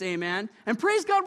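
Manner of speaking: normal talking voice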